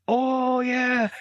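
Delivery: drawn-out, high-pitched realization; sudden recognition. Retold or imitated. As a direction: act out remembering it all at once. Acting out a sudden recollection.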